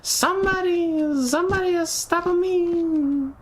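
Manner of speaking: high, slowed mock distress; mock panicked squeak. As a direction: squeal out a fake alarm. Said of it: "you're just like".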